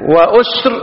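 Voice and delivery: وأسر as wa-usr, slowly; damma on hamza. Shown as a demonstration. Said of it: "بضم الهمز". (وأسر بضم الهمز)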